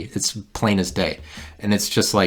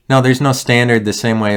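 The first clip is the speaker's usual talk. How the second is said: heated; 4 semitones higher, 5 dB louder than usual. Real, not acted. Still ranting, raised voice.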